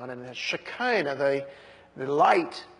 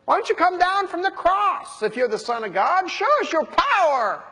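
high-pitched mocking heckle; jeering; 10 semitones higher, 4 dB louder than usual. Crowd daring Jesus to come down from the cross, jeering. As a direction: taunt him like a heckler in a crowd. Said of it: "jeering at him and mocking him".

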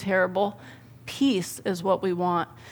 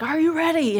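put-on excited kid; 7 semitones higher than usual. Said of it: "He's like".

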